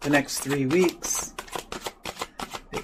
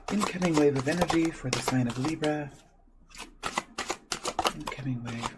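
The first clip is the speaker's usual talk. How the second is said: soft, quick repeated chant; murmured invocation; 8 dB quieter than usual. Real, not acted. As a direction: murmur it over and over. Repeating the invocation while shuffling.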